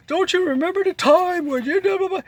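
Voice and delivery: high put-on voice of a pandering politician; mocking campaign voice. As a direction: sweet-talk voters in a mocking high pitch.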